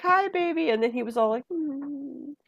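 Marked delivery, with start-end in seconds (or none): 0.0-0.7 much higher, sweet hello to a newborn; baby talk greeting. Retold or imitated. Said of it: "I was like". 1.5-2.4 much softer imitated newborn hum; a tiny contented baby sound; baby murmur. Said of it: "Reenactment of the baby".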